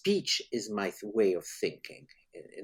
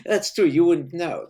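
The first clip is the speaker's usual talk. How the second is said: laughing through the words, louder and higher; amused, laughing reply to a joke; 8 semitones higher, 9 dB louder than usual. Real, not acted. Guest laughing at the host's joke about the couch, laughing.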